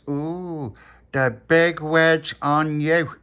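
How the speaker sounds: loud, high, slow taunt; exaggerated mock-impressed crowing; teasing mockery. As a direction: draw it out to poke fun. Tony mocks Pappy's long word.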